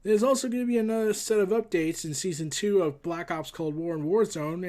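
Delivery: normal talking voice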